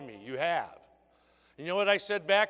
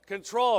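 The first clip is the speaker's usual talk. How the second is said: Control said louder, punched alone; stress on Control; 3 semitones higher, 6 dB louder than usual. Shown as a demonstration. Trainer answering his own question, stressing Control. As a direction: hit the word Control hard. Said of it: "what's the most important word".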